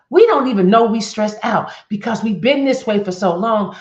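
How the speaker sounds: loud preaching. Real, not acted. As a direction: press it on the congregation. Preacher stressing a point loudly.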